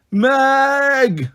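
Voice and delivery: high, drawn-out shout; shouted war cry. A narrator's reading. Narrator crying out as Meg leaps, shouting. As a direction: yell after a reckless friend. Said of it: "I shouted, half war cry".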